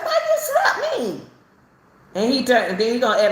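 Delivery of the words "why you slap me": much higher, startled cry of disbelief; retold shocked outcry at the husband; shocked question. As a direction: yelp it out like you just got hit awake. Retelling being slapped awake.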